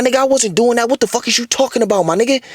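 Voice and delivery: high-pitched, quick protest; retold indignant denial. The woman denying what he accuses her of, indignant. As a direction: act out her offended comeback.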